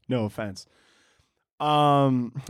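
ordinary talk

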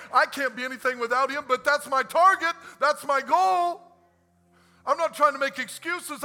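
loud, fast, fired-up pastor; full-force preaching. Acted. Pastor pours out his heart to the congregation, fired-up preaching. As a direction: preach it hard and let it build.

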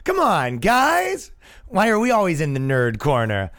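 high-pitched, pleading mock whine; hammed-up plaintive voice of a company; whining league. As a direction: complain like an unpopular kid begging for friends. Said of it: "the N H L has to speak".

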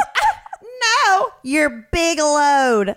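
can barely get the line out for laughing; laughing through it. Retold or imitated. Retelling the old man's dirty punchline, breaking into laughter. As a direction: squeal then slow, laugh-choked punchline.

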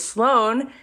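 thrilled shout-out, high and drawn out; excited praise. Real, not acted. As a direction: gush over the news. Celebrating listener's win.

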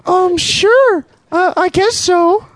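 hesitant high falsetto; unsure young woman, high and slow; man doing a woman's voice. Acted. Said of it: "girl voice".

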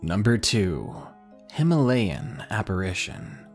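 plain reading voice

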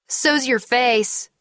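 high, drawn-out mocking retort; childish taunt. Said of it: "started giggling".

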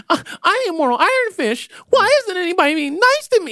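high, whining self-pity; whiny hero voice. Retold or imitated. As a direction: whine like a sulky kid. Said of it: "Whereas in Iron Fist".

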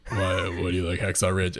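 low, dry imitated deadpan; John Benjamin deadpan. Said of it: "like, totally deadpan".

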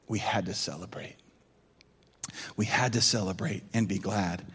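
father soothing, fading to whisper; tender father. Acted. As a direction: speak gently to a hurt son. Father answers gently in scripture.

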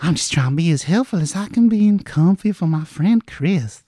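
high, soft, coaxing creature voice. Acted. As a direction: coo it gently like a too-sweet nurse. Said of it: "a very soft voice".

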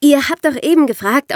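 normal talking voice

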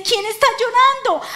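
high, loud, urgent question; heaven stirred. Acted. Preacher voicing heaven moved by someone weeping, stirred cry. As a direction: call it out high, deeply stirred. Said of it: "el cielo se conmueve y dice".

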